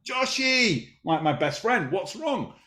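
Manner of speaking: father soothing his son who is crying; tender concern. Retold or imitated. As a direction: gently ask your upset little boy.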